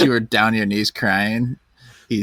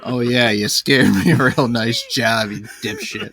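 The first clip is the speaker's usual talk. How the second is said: slow, laughing sarcasm; mock sarcastic praise; slower than usual. Unimpressed dad answering his kid's rubber snake prank, sarcastic. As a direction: draw out the fake compliment scornfully.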